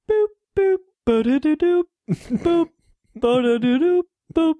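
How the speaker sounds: high bouncy sung game tune; playful high chiptune scat; Mario music. Shown as a demonstration. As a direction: hum the jingle like an 8-bit game. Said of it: "the music ... is like Super Mario Brothers".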